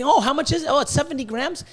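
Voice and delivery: mock a gullible customer lightly; naive buyer. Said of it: "and saying".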